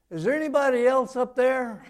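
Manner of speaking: loud, high disbelieving cry; frantic punchline shout. Acted. Dangling man rejects God's offer, loud high shout. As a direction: yell up in panic for a second opinion.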